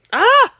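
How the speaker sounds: loud high "ah" of release; emotional cry. Real, not acted. Crafter releasing emotion mid-demo, loud high cry. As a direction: shake off the tears with a cry.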